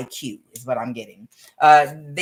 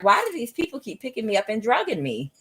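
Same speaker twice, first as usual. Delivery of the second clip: cat's voice; 7 semitones higher than usual. Retold or imitated. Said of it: "She was like".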